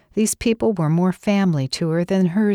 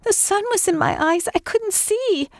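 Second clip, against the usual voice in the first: much higher pleading woman's voice; distraught driver. Acted. Driver explaining the crash to Kathy, high pleading voice. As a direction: plead your excuse up high.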